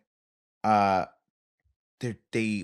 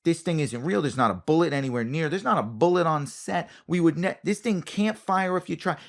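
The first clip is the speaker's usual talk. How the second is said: higher, emphatic reassurance; a hint of an insistent coordinator; 6 semitones higher than usual. Coordinator insisting to the actor the gun is fake, emphatic. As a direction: spell out the safety rules emphatically. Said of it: "they show you and they go".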